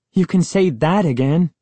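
bright, pleased agreement; appreciative. A narrator's reading. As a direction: say it with real approval. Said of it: "Sari said appreciatively".

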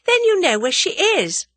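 high, loud eager question; excited cry. A narrator's reading. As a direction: ask it eagerly, pitched right up. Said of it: "cried Tuppence".

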